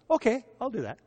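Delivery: high, eager quick yes; eager friend. Retold or imitated. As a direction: jump at the free lunch offer. Friend eagerly accepts lunch offer.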